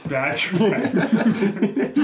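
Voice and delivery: high loud laughing take on it; laughing repeat. Retold or imitated. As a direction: crack up while repeating. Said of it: "he's like".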